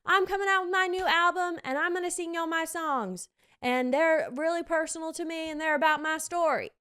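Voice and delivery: put-on musical's lead in a twangy country accent. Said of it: "my country accent".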